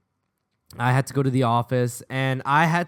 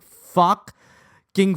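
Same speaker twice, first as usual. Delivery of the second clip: long drawn-out swearing; exasperated curse; 5 semitones higher, 5 dB louder than usual. Retold or imitated. Boy learns his friends told, drawn-out exasperated curse. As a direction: stretch the curse out in disbelief.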